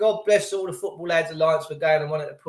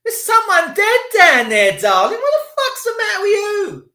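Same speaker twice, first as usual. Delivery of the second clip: higher and louder, a scandalised telling-off; indignant retort; 9 dB louder than usual. Retold or imitated. Snapping back at the cheering girl, indignant. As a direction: scold her in disbelief. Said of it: "And I'm like".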